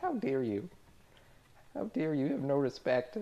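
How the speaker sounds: hammed-up offended purist; mock pissy indignation. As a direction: huff at them like a prissy roleplayer. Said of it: "the same, like, pissy attitude".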